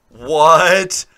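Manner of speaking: loud startled what; surprised shout. Real, not acted. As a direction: blurt it out in disbelief. Shocked at Ireland taking Japan.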